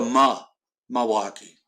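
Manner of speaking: "Milwaukee" as "Muh-waukee", no l; local "Milwaukee". Shown as a demonstration. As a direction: open "Milwaukee" with "ma", not "mill". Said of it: "like a ma".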